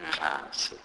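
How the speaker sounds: "nxasi" with a nasal click; Sepedi click demo. Shown as a demonstration. Teacher sounds out the word for sorry, clicked "nxasi". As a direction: click the X after a hummed N in "nxasi". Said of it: "this sound is non-existent in English".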